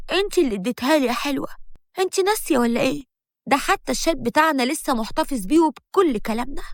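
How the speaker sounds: ordinary delivery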